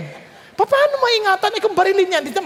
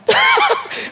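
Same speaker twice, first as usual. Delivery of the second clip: high burst of laughter at his own joke; real laugh; 4 dB louder than usual. Real, not acted. Preacher laughing at his own car joke, burst of laughter. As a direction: laugh out loud at the punchline. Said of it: "Pero biro lang po yun".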